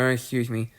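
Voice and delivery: ordinary talk, nothing marked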